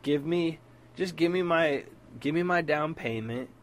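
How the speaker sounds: pushing hard for his money back; heated demand. Retold or imitated. Demanding his down payment back, heated.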